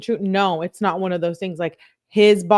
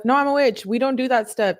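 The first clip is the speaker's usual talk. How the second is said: usual speaking voice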